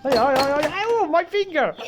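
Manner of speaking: playful fake ouch; mock pain cries. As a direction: ham up a hurt finger. Pretend hurt finger, laughing.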